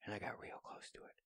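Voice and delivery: normal talking voice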